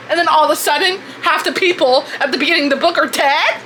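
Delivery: high, loud, disbelieving outbursts with laughter; replayed shocked reactions. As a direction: relive the shock of reading it. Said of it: "here are some of the reactions".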